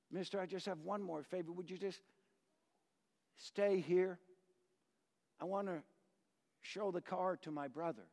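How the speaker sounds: timid request. Acted. Poor boy asking timidly.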